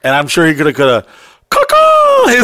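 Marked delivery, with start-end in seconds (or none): 1.5-2.3 loud, high, drawn-out caw; long high-pitched mock crow call; imitated bird call. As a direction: screech a bird signal to summon backup.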